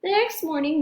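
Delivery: ordinary delivery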